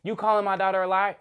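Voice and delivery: put-on affronted question; mock indignant challenge. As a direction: mimic her snapping back. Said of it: "So she trying to snap on me".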